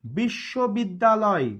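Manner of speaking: বিশ্ববিদ্যালয় slowly, in one connected word; careful slow pronunciation. Shown as a demonstration. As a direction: draw বিশ্ববিদ্যালয় out clearly. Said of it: "একটু ধীরে ধীরে উচ্চারণ করেন". (বিশ্ববিদ্যালয় ধীরে, স্পষ্ট করে)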